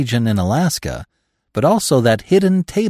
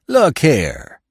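louder, sharp call; cry out; 4 semitones higher, 4 dB louder than usual. A narrator's reading. Trapper wheeling on the narrator, crying out. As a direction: spin round and call out. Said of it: "he cried".

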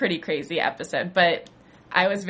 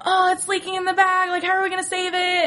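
much higher, squealing panic; panicky quote; 12 semitones higher, faster than usual. Retold or imitated. Gang panicking over the leaking blood bag, squealing high. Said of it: "And they're like".

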